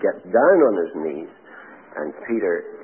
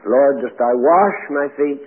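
"wash" punched, louder and higher, said slow; stress on wash; 7 semitones higher, 7 dB louder than usual. Shown as a demonstration. Preacher showing a wrong stress, hitting "wash" hard. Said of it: "No, it's not that either".

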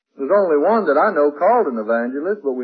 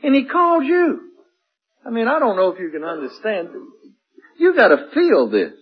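higher, louder and slowed, building the vision; impassioned preaching; 11 semitones higher, 4 dB louder than usual. Acted. Preacher pictures the listener called before the Lord, rising fervor. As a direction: pull them into the scene, rising.

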